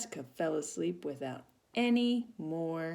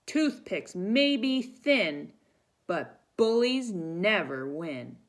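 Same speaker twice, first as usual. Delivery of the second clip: slow, loud, firm declaration; 4 semitones higher, 6 dB louder, much slower than usual. Acted. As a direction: stand tall and proclaim it. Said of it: "In a loud voice".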